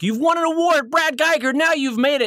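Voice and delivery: high-pitched admirer's praise; gushing congratulations. Acted. Admirer to cartoonist who won an award, gushing. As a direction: hype up the big winner.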